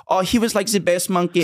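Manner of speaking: mimicking the monkey owner with a doting gush; German monkey lady. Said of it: "she's like".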